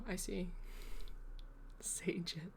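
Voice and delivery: soft, breathy aside; whispered. Real, not acted. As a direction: whisper Sage it to yourself. Quietly gets chat's pun.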